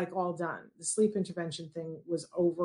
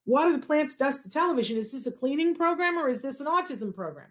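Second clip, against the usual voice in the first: high-pitched incredulous protest; acted indignant questioning, pitched well up; exasperated disbelief; 6 semitones higher than usual. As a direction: list the chores as if they're absurd. Mother protests the absurd chores, pitched up.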